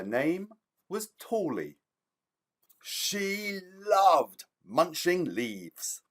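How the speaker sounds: lively storybook reading with big pitch swings; reading to children. A narrator's reading. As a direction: read it like story time for little ones. Author reads child's story aloud.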